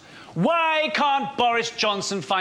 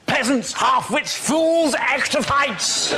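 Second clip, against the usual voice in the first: loud mock-pompous bellow at the crowd; hammed-up toff barking insults; Boris Johnson shouting; 3 semitones higher, 3 dB louder than usual. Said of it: "Boris Johnson's commentary".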